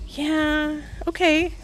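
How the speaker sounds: mock awkward goodbye; nervous brush-off. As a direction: hurry off the phone, hiding something. Nervous reply to mom, retold.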